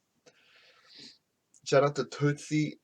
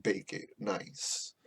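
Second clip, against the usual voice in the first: slow, unvoiced whisper of a sales line; whispered pitch; 3 semitones lower, 7 dB quieter, much slower than usual. Bakery owner pitching cheesecake, slow hushed whisper. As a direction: breathe it out slow and hushed like a secret.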